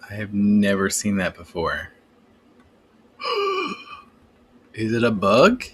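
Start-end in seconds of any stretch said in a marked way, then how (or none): 3.2-3.9 gasp. Real, not acted. Sees something strange in the program.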